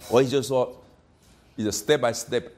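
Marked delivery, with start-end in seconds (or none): none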